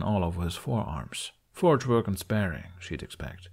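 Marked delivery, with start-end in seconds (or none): none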